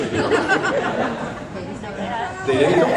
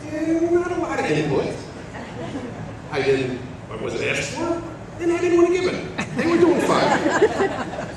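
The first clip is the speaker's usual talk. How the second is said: high laughing, slowing down. Real, not acted. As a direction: chuckle through the joke. Joke about having no input.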